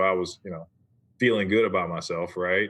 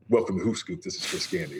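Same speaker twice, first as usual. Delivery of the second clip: understated radio sign-on, sinking softer at the end; old radio voice; 2 semitones lower, 4 dB quieter than usual. Shown as a demonstration. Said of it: "I had my old radio voice".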